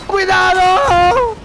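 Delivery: loud, drawn-out high warning; warning yell. Real, not acted. Gamer warning his ally, long loud yell. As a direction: stretch the warning out slow and loud.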